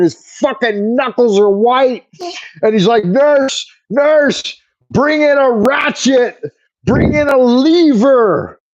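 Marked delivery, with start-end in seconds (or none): 3.0-8.6 high-pitched, slow mock yell at the nurse; imitating a yelling dentist. Dentist shouting to the nurse for more tools, high mock yell. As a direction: call for tools like a frantic dentist. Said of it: "he's yelling all this shit".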